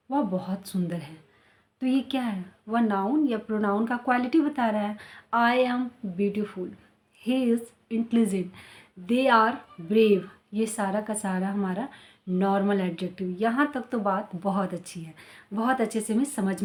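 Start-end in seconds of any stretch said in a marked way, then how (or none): 5.3-10.2 very slow, clear example sentences; carefully paced teacher demo; slow model sentences. Shown as a demonstration. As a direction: spell each sentence out slowly for the class. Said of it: "teacher models example sentences slowly".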